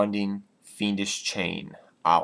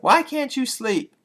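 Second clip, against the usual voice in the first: put-on girlfriend voice; 5 dB louder than usual. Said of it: "she's like".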